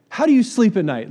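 high, loud accusing jab; indignant retort. Retold or imitated. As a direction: throw it at the scammer. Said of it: "instantly just responded".

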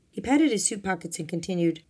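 usual speaking voice

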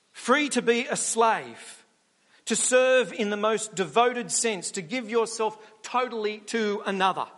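preacher stressing slave. Real, not acted. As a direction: let Free land heavily. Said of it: "slow emphatic point".

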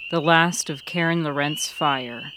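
ordinary delivery

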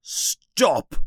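high-pitched, restrained yell; thin mock yell of protest. A narrator's reading. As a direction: cry out high without much volume. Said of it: "yells Uncle Vernon".

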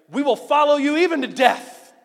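high, loud bold pledge; Peter. Acted. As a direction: pipe up with a booming vow of loyalty. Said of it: "Peter pipes up and is like".

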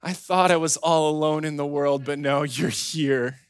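imitated contented baby, slowing at the end; relieved smiling baby. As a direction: say it through a big, blissful smile. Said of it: "eyes closed and this really big smile".

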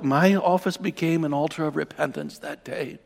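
usual speaking voice

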